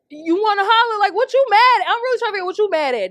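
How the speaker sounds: loud high angry challenge, rushing; heated yell. Real, not acted. Ranting at a commenter who hollered at her, loud angry yell.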